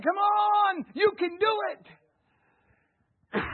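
high, loud, stretched-out encouraging shout; cheering shout. Acted. Jesus cheering the believer on, shouted high and slow. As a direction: yell it like a coach urging them on.

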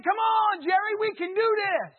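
high, loud shout of encouragement; cheering shout. Acted. Jesus cheering on Jerry, shouted high and loud. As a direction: bellow it like a coach. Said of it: "He's saying".

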